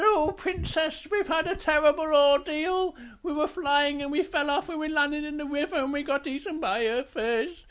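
high bear voice pouring out a tale; breathless storybook bear. Acted. Bear tells princess of terrible ordeal, high-voiced.

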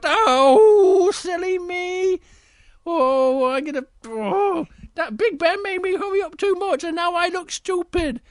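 very high, squeaky wailing bear; high-pitched mortified bear; embarrassed Little Bear. Acted. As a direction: squeak out your embarrassment, drawing out the groans. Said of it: "he was so embarrassed".

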